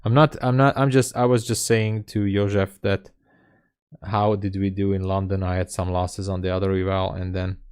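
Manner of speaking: ordinary talk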